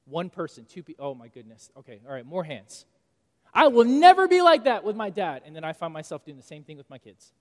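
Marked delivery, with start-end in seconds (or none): 3.5-5.4 loud, high mock teenage vow; defiant vow. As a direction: shout it like a stubborn teen swearing off dad. Voicing defiant younger self.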